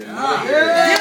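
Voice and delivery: rousing finish. Real, not acted. Rousing end of hearing speech.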